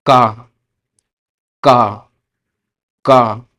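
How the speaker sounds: का as Spanish "ka", firm; Spanish letter name. Shown as a demonstration. Teacher to students, careful Spanish letter name. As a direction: repeat का clearly. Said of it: "के को क्या बोलते हैं".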